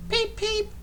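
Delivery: very high whistled peep; high whistle peep. A narrator's reading. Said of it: "Peter Sam whistled".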